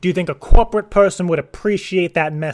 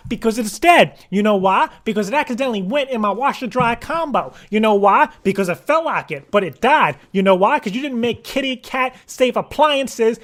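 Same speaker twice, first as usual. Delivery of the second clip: mock-furious, higher-pitched tirade; angry rant; 5 semitones higher, 3 dB louder than usual. Caller rehearsing a message about his dead cat, angry. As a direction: hammer out an escalating, indignant accusation. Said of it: "a vocal shock when they answer the phone".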